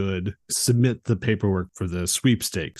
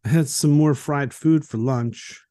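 put-on drunk bar guy; 5 semitones higher than usual. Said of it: "And then the guy goes".

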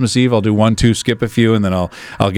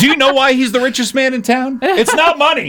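high laughing mock movie line; hammed-up film character voice; movie quote impression; 13 semitones higher than usual. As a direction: quote it in a much higher, giggly voice. Quoting the movie playfully.

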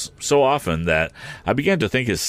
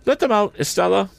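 put-on higher, haughty old lady voice; cold lady of the house looking them over; Miss Havisham; 12 semitones higher than usual. A narrator's reading. Miss Havisham addressing Joe, haughty.

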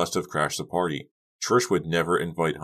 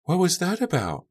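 put-on very high girlish question; high-pitched, puzzled gossip; nosy bystander. A narrator's reading. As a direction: ask as a curious party girl. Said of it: "a girl asked from nearby".

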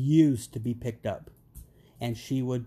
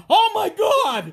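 high, loud, drawn-out gasp of shock; shocked exclamation; 11 dB louder, slower than usual. Retold or imitated. Dad reacting to his daughter's bandage, loud and shocked. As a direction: blurt it out, horrified at the wound. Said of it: "I look at her and go".

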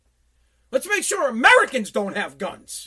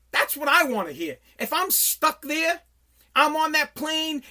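ordinary delivery

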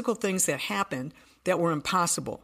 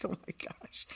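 whispered; 11 dB quieter than usual. Retold or imitated. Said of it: "I'm like".

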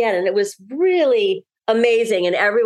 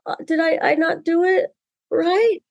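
lightly voiced small boy, pitched higher; a small boy asking if he did it wrong, higher and unsure; a hint of a nervous child, pitched up; little boy's worried question; 7 semitones higher than usual. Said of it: "the little boy goes".

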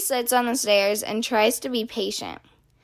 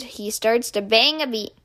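plain reading voice

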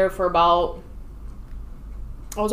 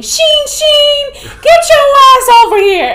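high, loud Brooklyn bark; drill instructor shout; 13 dB louder, slower than usual. Retold or imitated. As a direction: yell it across the barracks. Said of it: "imagine her saying".

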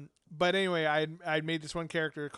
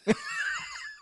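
shrieking laugh. Real, not acted. Shocked laugh at awkward flirting.

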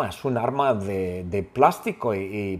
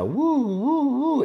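high wailing "uh" like an ambulance; drawn-out siren howl; imitated siren; 13 semitones higher, much slower than usual. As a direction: mimic a slow siren, far higher than speech.

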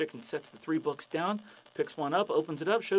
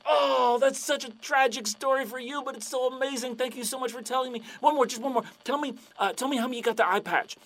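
higher, pleading and excited; eager fan; 9 semitones higher, 4 dB louder than usual. Acted. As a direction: beg for the story. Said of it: "the guy's like".

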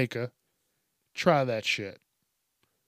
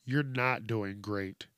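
lower, softer, slow put-down; deadpan rebuttal; 4 semitones lower, 5 dB quieter, slower than usual. Real, not acted. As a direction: deflate the cheer slowly and quietly. His own rebuttal to the cheer.

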